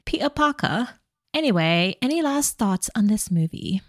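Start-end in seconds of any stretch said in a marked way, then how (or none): 0.0-0.9 high-pitched mock British PETER PARKER; British accent. Co-host mimicking how the British say it, British accent. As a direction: parody the British with dropped r's. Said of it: "The British be like".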